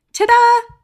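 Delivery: high, loud triumphant ta-da. Acted. Cloud guy revealing the troll tree, loud triumphant. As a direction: announce it like a magic trick. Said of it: "the cloud said".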